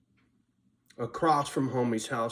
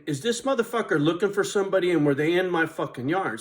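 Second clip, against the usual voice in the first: louder, higher, racing thought; worked-up suspicion; 5 semitones higher, 4 dB louder than usual. Retold or imitated. Suspecting the cop was looking for someone, worked-up voice. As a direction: wonder aloud, getting worked up.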